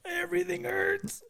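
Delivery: very high, slow, strained voice; dazed moan. Retold or imitated. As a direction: squeeze it out high and slow like you're barely conscious. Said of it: "I remember saying".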